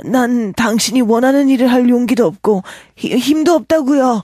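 high, fast, stammering plea; anxious protest. A narrator's reading. Marvel tells his captor he lacks courage, anxious protest.

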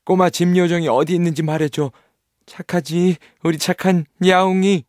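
put-on wheedling little boy voice; coaxing boy. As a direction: flatter the kitty to get help. Nils coaxes the cat.

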